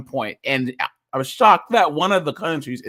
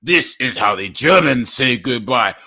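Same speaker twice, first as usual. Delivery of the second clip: German guy's farewell; 5 semitones lower, 6 dB louder than usual. Retold or imitated. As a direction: lower and louder mock-tough German man. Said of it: "And then the German guy goes".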